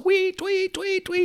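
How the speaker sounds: high whistled tweets; sharp high mock whistle blasts; imitated police whistle. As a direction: mimic a cop blowing his whistle. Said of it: "I hear this whistle".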